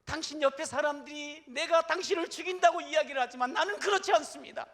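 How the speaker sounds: loud, high call across the distance; preacher voicing David's loud cry. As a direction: shout it after the departing king. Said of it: "큰 소리로 소리를 지르면서".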